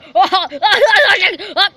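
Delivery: loud, high villain cackle; hammed-up gloating cackle; evil laugh. Villain gloating over takeover.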